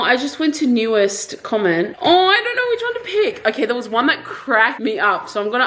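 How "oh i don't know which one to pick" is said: high, quick giggly indecision; giggling. Real, not acted. Giggling while picking a comment from viewers, quick and high. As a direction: laugh through the words, torn between comments.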